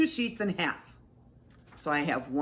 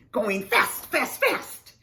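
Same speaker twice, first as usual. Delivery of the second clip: loud, rising run of "fast"; excited speeding; 3 semitones higher, 5 dB louder, slower than usual. A narrator's reading. Something speeds past the animals, excited. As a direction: punch each "fast" louder and higher.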